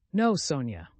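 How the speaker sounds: plain reading voice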